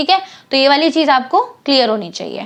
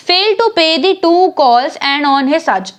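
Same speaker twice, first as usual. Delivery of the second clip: slow reading; much slower than usual. Shown as a demonstration. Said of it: "reading question text slowly".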